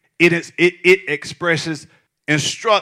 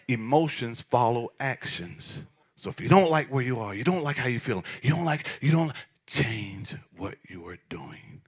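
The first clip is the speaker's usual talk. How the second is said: dropped to a whisper; 6 semitones lower, 11 dB quieter than usual. Shown as a demonstration. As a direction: let the point land in a hush. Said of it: "I'm gonna say it again".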